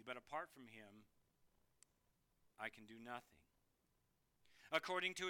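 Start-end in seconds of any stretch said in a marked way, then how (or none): 0.0-3.4 low, very soft and sober; quiet, low confession; hushed admission. Real, not acted. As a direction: drop right down to a humble hush. Humbled half of a preached contrast.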